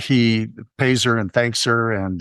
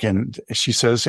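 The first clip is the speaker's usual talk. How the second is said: ordinary delivery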